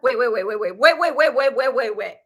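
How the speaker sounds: rapid wait, wait, wait with rising urgency; excited repeated wait. Retold or imitated. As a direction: stop yourself mid-realisation. Realising the twist.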